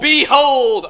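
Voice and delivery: loud, high, drawn-out cry; ritual group shout. Pretend. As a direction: join in with a big hammed-up shout. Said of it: "you gotta shout".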